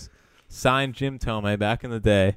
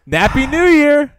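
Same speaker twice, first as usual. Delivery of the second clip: loud high singsong tease; singsong taunt; 11 dB louder than usual. Acted. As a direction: belt it out like a gleeful taunt. Teasing sick cohost with jingle.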